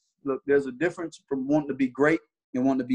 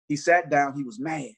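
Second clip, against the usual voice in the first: ordinary talk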